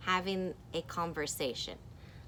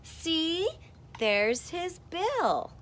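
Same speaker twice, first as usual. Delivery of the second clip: high slow insistent character voice; pointing character; 11 semitones higher, 4 dB louder, much slower than usual. Acted. Teacher reading duck-seer pointing at the bill, insistent. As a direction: show the proof slowly. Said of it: "This person says".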